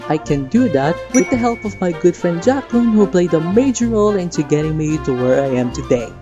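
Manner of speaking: normal talking voice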